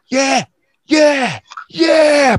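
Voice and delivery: high, rising yeah yeah yeah; hyped cheering. Retold or imitated. Playing ping pong at night, high rising hyped cheer. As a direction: celebrate a ping pong point. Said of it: "I'm like".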